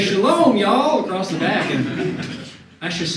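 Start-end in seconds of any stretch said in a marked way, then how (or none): 0.0-1.0 Shalom, y'all with a loud Texan drawl on y'all; Texan twang. Shown as a demonstration. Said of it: "And it says".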